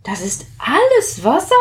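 high, astonished little question; amazed. Acted. Plitsch marvels at all the water, high and amazed. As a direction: ask it in wonder. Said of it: "staunte Plitsch".

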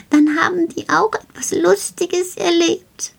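an excited little gnome sharing his nightly plan; Karl the shelf gnome. Acted. Gnome tells the child he will come every night, lightly voiced and eager.

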